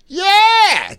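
very high, loud cheering yell; kid cheering. Retold or imitated. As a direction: whoop like a thrilled kid at a game. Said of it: "going like".

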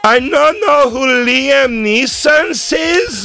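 high, loud, slow weepy praise; mock emotional judge. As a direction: gush tearfully, slowly.

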